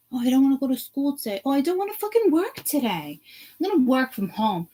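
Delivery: high, fast whiny mimicry; mock whiny worker. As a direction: whine like a lazy employee. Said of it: "mock whiny quote".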